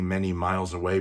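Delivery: ordinary delivery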